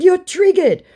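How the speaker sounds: very high, loud tease; mock taunting. Baiter teasing the riled scammer, taunting.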